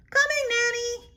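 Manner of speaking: high, bright call to Nanny; Eloise calling. Acted. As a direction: sing out to Nanny from the other room. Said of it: "I say".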